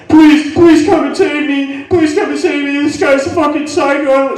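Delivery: high, loud hammed-up begging; panicked pleading. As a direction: beg for rescue down the phone in a panic. Panicked man phones wife for rescue.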